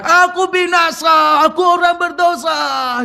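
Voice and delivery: full shout of a doomed sinner; Isaiah shouting. Retold or imitated. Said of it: "Yesaya berteriak".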